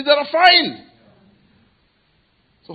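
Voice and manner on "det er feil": loud high cry of wrong; shouting protest. Acted. Believer rising up in the crowd, shouting that it is wrong. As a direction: shout it out against injustice.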